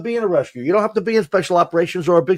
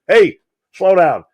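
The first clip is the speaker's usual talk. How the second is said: slow, louder call to slow down; mock command; 6 dB louder, much slower than usual. Tough captain telling the driver to slow down, slow and louder. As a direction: stretch out the order firmly. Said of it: "being verbal with".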